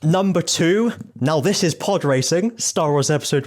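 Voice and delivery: high rising number call; bingo caller. Shown as a demonstration. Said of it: "I'm, like, doing bingo calling".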